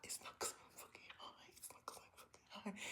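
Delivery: frantic hissed whisper to yourself; whispered denial. Retold or imitated. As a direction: insist in an urgent whisper. Retold panicked denial to herself.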